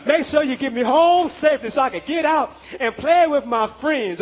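normal talking voice

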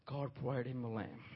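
very soft, low murmur; hushed reverence. Real, not acted. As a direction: repeat it very quietly, almost to yourself. Preacher repeats it almost inaudibly.

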